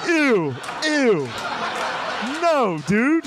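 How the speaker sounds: high, drawn-out ews; mock group disgust. Fellow congressmen reacting to the first answer, high mock disgust. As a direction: recoil in high-pitched, hammed-up disgust. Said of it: "And everyone's like".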